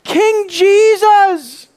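high, loud, stretched-out cry; soaring proclamation, each word drawn out; shouting. Acted. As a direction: hail the king at full voice. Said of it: "as he's shouting".

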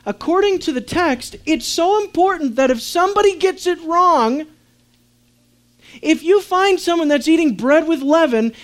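high, loud, insistent sermon build; emphatic preaching. Real, not acted. Preacher telling how seriously God takes leaven, forceful and urgent.

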